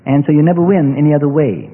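ordinary talk

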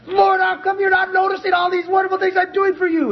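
high shouted whine; mock complaint to God. Believer complaining to God, mock high shout. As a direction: cry out at God, aggrieved. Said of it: "you kind of feel like".

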